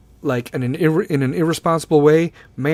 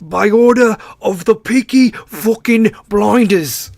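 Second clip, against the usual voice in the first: loud, slow, higher hammed-up English accent; mock English accent; 5 semitones higher, 6 dB louder, slower than usual. Podcaster imitating a Netflix show, hammed-up English accent. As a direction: put on a dodgy TV English accent. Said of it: "I learned my English accent via Netflix".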